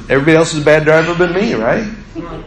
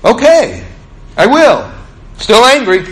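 imitating girl giving in; 8 semitones higher than usual. Schoolgirl agreeing to ask the driver next time, high. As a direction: chirp it up like a kid who just got it. She went.

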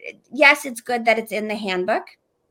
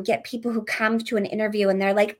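usual speaking voice

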